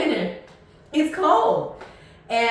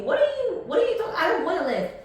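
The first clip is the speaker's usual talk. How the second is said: can't believe she's offering a lift; refusing the friend's offer of a lift in disbelief; exasperated; 12 semitones higher, much faster than usual. Retold or imitated. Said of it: "I was like".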